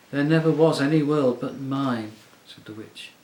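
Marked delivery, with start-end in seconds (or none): 0.1-2.1 louder, possessive command. A narrator's reading. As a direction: claim it all as hers. Witch asserts her enchantment.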